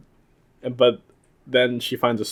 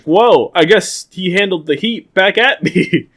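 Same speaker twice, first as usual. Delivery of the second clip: louder, higher, slow mock surprise; mock surprised; 7 semitones higher, 6 dB louder, slower than usual. Zeto hit back by the monster, loud mock surprise. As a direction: gasp it like a stunned hero. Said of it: "he's like".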